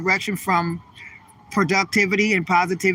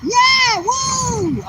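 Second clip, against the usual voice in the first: very high, loud whooping cheers; hammed-up party woo; woo shout; 4 dB louder, much slower than usual. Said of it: "I just did the woo thing, you know, that's not me".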